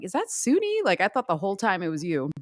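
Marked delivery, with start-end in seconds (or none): 0.0-0.8 surprised question. Retold or imitated. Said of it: "And I was like".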